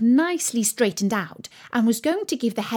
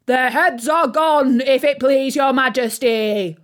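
loud, raised, drawn-out military report; shouted reply; 4 semitones higher, 6 dB louder than usual. A narrator's reading. Soldiers report back to the Queen, shouting. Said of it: "the soldiers shouted in reply".

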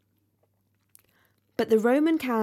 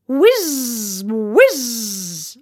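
whizzing sound; 7 dB louder than usual. A narrator's reading. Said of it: "went the Catherine wheel".

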